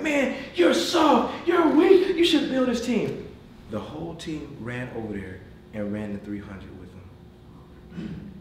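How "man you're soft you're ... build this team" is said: much higher, slow, jeering taunt; mock taunting. Teammates taunting a kid who can't finish, mock jeer. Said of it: "instead of guys like".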